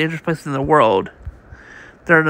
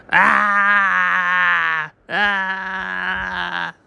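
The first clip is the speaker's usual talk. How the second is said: long pained cry, higher pitched; anguished scream; 3 semitones higher than usual. Acted. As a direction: scream out in agony. Prisoner cries out in pain.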